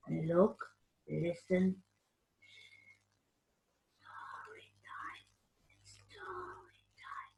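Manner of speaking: breathy hushed story time; whisper. Acted. Whispering to the children to gather for story time.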